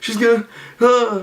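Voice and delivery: high panicked gasp of dread; sudden high-pitched teen panic. Retold or imitated. Teen realizing the lady saw his face, high and panicked. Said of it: "so I was like".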